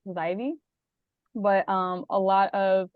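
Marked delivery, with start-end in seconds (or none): 0.1-0.5 Zaivy with the AI said like the word eye. Shown as a demonstration. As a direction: say Zaivy with a long I and a clear V. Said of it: "it's the A I for I".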